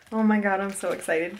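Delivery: ordinary delivery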